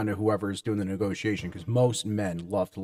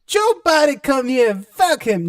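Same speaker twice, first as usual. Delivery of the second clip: much higher, loud put-on rocker yell; 9 dB louder than usual. Friend mocking [name], high and shouting. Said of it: "mock [name] voice".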